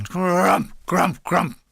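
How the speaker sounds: rolled, growled crump of shells; imitated mortar blasts. Narrator mimicking mortars landing on the hill, imitated blasts. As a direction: voice three mortar explosions.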